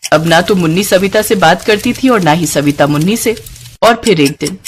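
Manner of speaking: usual speaking voice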